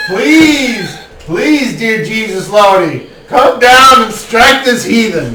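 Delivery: loud pleading call to the heavens, slowing at the end; mock prayer. Game master calling on a god to strike the player, loud mock prayer. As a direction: beg the god to smite him.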